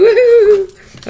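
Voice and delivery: laughing high whoop of joy; excited whoop. Real, not acted. Celebrating a win, laughing excited whoop. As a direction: cheer with a laugh.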